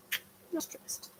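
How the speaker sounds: soft, slow whisper; whispered repeat to the dog. Real, not acted. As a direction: breathe it out to herself. Repeats it under breath.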